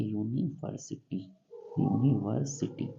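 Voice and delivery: यूनिवर्सिटी drawn out slowly, opening "yu" clear; slow pronunciation demo. Shown as a demonstration. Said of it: "उच्चारण में लिखेंगे". (यूनिवर्सिटी का धीमा उच्चारण)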